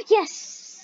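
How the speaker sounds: high, drawn-out hissed yes; excited cheer. Real, not acted. Player relieved someone joined, excited cheer. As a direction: celebrate with a high burst. Someone finally joins the game.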